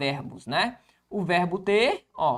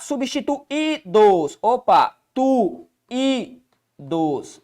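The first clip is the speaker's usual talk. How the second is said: slow syllables; much slower than usual. Shown as a demonstration. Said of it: "spelling out syllables".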